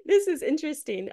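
high laughing wonder, voice cracking; amazed laughing aside about metaphysics; laughing amazement. Retold or imitated. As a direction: giggle in disbelief at the discovery. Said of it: "I was like".

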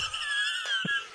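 high screaming laugh; shrieking laugh. Real, not acted. Shouted laugh after German bit.

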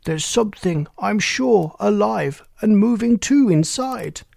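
a startled cry that builds as the fear grows; cry of consternation. A narrator's reading. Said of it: "with consternation cried".

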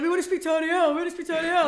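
hyped-up callers begging for the DJ, excited. Retold or imitated. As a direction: chant it like a rowdy stag party. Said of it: "they were, like, really excited".

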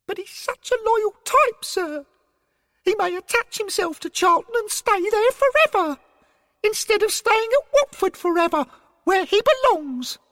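very high, squeaky, earnest character. A narrator's reading. Derek fretting to his superior about losing him, high-voiced. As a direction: plead it in a piping voice. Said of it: "in his high voice".

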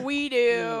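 loud chorus of a crowd; crowd answering loudly. Retold or imitated. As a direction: shout back as the audience. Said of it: "they're all like".

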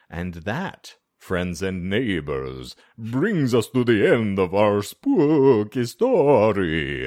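very slow, hammed-up spooky sign-off; spooky storyteller. Host closing the spooky story for friends and neighbors, hammed-up and drawn out.

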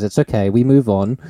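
normal talking voice

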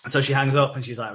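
ordinary talk

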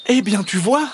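high, gloating hedgehog voice; pleased high-pitched jibe; triumphant hedgehog. A narrator's reading. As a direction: point it out smugly, pitched high. Freed hedgehog gloats to fox.

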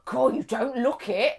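imitating a Cockney woman in disbelief; lightly put-on Cockney protest. Cockney woman reacts to friend's age, Cockney. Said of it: "She's very Cockney".

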